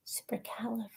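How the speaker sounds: hushed, trying the word out; soft whisper of a tongue-twister. Real, not acted. Whispering the long name.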